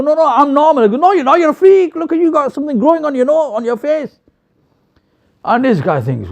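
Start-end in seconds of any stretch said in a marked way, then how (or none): 0.0-0.8 mock protest. Acted argument over who is normal. 1.0-4.2 high-pitched, incredulous mock jeering; mocking accusation. Noseless local calls the newcomer a freak, mocking accusation.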